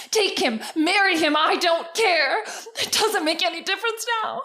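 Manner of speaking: a loud, sobbing protest; tearful outburst. Acted. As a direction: let it break into sobs as you shout. Sister's bitter tearful outburst.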